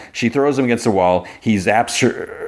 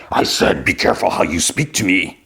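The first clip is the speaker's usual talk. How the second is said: distorted voice. Retold or imitated. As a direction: imitate a distorted voice warning someone off. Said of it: "he's, like, distorted".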